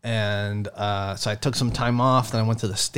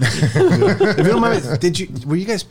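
laughing; 5 semitones higher, 3 dB louder, slower than usual. Real, not acted. Laughing polite lead-in.

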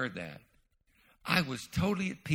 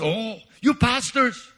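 put-on slow, loud Korean-accented pastor; a Korean preacher's drawn-out call; mock Korean accent; 8 semitones higher, 8 dB louder, slower than usual. Korean pastor addressing the pastors at a conference, in a mock Korean accent. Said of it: "I don't have a good Ch-, uh, Korean accent".

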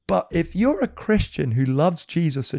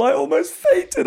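amazed; 13 semitones higher, 5 dB louder than usual. Real, not acted. Amazed retelling.

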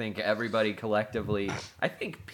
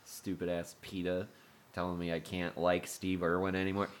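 normal talking voice

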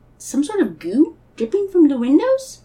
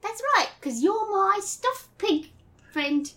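high-pitched English schoolboy; little British boy accent; 7 semitones higher, 4 dB quieter, slower than usual. Acted. Christopher Robin greets Piglet in a little British boy accent. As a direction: announce yourself as a small British boy. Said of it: "your little British boy accent".

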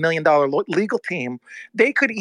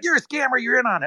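accusing; 7 semitones higher, faster than usual. Retold or imitated. Ryan accusing him, retold.

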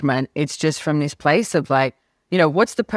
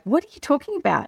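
incredulous; 11 semitones higher, faster than usual. Retold or imitated. Incredulous at Gary's claim.

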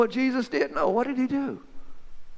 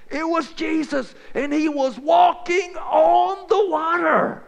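higher, louder and slow, almost shouting; excited shout; 7 semitones higher, 3 dB louder, slower than usual. Retold or imitated. Disciple's friend telling how Jesus walked on water, shouting in awe. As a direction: proclaim the miracle with awe.

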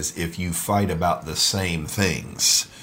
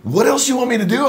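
much higher, louder, put-upon; mock exasperated reply; 6 dB louder, faster than usual. Husband replays his exasperated answer to wife. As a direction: protest like a harried husband. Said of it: "I'm like".

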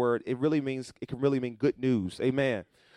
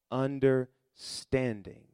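even slower, settling; slower repeat; 2 semitones lower, much slower than usual. Shown as a demonstration. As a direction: let it sink, slowly. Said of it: "repeated slower".

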